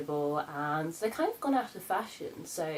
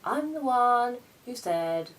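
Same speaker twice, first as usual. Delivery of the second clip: indignant; 7 semitones higher, 8 dB louder, slower than usual. Retold or imitated. Arguing with mum.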